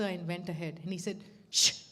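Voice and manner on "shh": sharp, loud "shh"; curt shush. Retold or imitated. Guru silencing a pleading devotee, curt shush. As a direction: imitate a brisk hushing. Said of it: "he's telling me".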